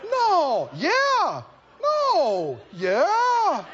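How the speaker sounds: high, drawn-out back-and-forth; childish bickering. Acted. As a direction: squabble with yourself, swooping up on each word. Back-and-forth bickering, two acted parties.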